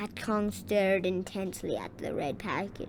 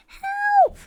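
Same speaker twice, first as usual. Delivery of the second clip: piercing squeaky cry, much higher and louder; high cry for help; 10 dB louder than usual. A narrator's reading. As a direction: yelp for help as a tiny mouse. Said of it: "cried the engineer".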